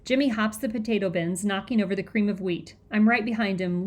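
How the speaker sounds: ordinary delivery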